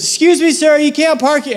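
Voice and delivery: woman guarding the lot, high pitched; stern lady. Retold or imitated. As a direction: mimic the lady shooing him off. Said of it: "she goes".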